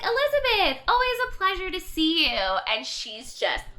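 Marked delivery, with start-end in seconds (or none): 0.0-2.4 high, loud, falsely delighted welcome; hammed-up smug boss greeting; mock-pleasant greeting. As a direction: greet her with exaggerated sweetness. Boss mock-greets the angry employee.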